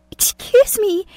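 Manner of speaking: high, shaken excuse me; flustered young woman. A narrator's reading. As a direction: blurt it out and flee. Trembling exit.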